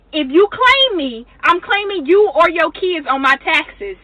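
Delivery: ordinary delivery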